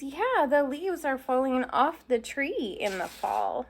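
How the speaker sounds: plain reading voice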